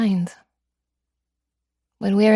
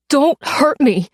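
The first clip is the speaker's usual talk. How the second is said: higher, louder pleading don't; frightened plea; 7 semitones higher, 6 dB louder, slower than usual. Acted. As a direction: beg for your life. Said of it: "he cries".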